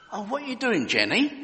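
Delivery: high, lightly voiced kindly neighbour; polite neighbour. Neighbour to the girl, mock polite high question. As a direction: ask sweetly over the fence. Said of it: "he politely asked".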